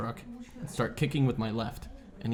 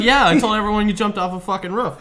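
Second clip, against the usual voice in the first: laughing brother quote; 9 semitones higher, 10 dB louder than usual. Retold or imitated. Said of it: "He's like".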